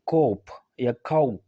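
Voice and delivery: "कोप" and "काउप", final p sounded; naive spelling readings. Shown as a demonstration. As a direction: misread as kope, then kowp, slowly. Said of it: "ज़ाहिरी तौर पर आप इसको ऐसे ही पढ़ेंगे". ("कोप" या "काउप", प के साथ ग़लत उच्चारण)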